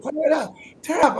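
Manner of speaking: high-pitched hype call, mock yell; imitated yelling. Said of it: "She was just yelling".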